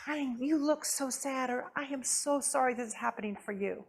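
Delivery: soft, tender sympathy; gentle comfort. Shown as a demonstration. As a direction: comfort them softly. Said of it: "and you say".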